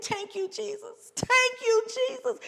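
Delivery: high, excited praise; high-pitched joyful gratitude; overjoyed thanks. Retold or imitated. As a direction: cry out thank you Jesus. Overjoyed grateful exclamation.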